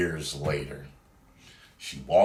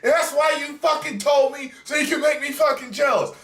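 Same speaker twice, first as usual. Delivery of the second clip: much higher, louder mock shrieking girlfriend; imitating angry girlfriend; 6 dB louder than usual. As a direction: play the jealous girlfriend losing it, high and loud. Girlfriend yelling, retold.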